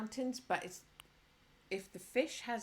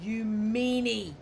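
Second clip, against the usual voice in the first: mock scolding; 8 dB louder, slower than usual. Game won't let her mount it.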